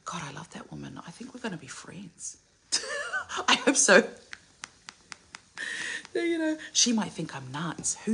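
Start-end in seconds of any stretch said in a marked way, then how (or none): 2.7-3.4 high giggle. Real, not acted. Laughs at hope of friendship.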